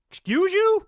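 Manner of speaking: high, incredulous squeak; outraged disbelief. Real, not acted. Host reacting to a politician's clip in high disbelief. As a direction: react as if you can't believe your ears.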